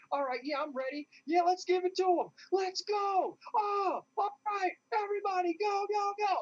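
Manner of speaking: rally the troops like a psyched player yelling go; pumped-up enemy team. Retold or imitated. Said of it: "I can hear them talking".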